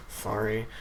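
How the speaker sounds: low, soft mumble; mumbled. Retold or imitated. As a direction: mutter it sheepishly. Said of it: "I mumbled".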